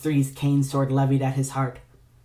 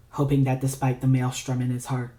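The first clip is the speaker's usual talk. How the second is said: usual speaking voice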